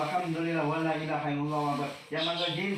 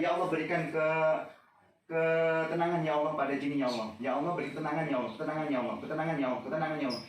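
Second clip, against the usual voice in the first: low, quickening prayer for calm; low hurried supplication; murmured prayer; 5 semitones lower, faster than usual. Real, not acted.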